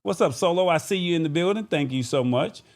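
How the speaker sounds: ordinary talk, nothing marked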